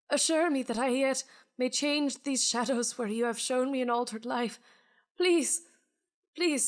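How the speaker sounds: haunted man pleading with the spirit for another chance; pleading ghost. Acted. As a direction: beg the spirit for mercy, Dickens style. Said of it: "He says".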